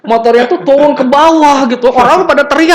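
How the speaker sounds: high, loud, rushing climax; excited storytelling. Real, not acted. Lecturer telling motorbike crash, excited. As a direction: tell the crash with rising excitement.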